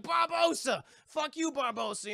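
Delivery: high, loud mock-heroic challenge; theatrical heroic yell; defiant shout. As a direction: call out the villain, hammed up. Hero acted calling out villain.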